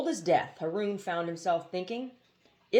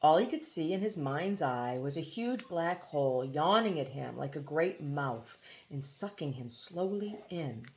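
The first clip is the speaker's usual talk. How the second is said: plain reading voice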